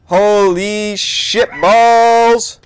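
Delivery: drawn-out loud shocked cry; shocked exclamation. Real, not acted. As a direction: stretch it out in shock. Sudden shock in game.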